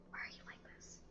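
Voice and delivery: hushed whisper under breath. Real, not acted. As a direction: whisper it to yourself. Whispered aside at game character.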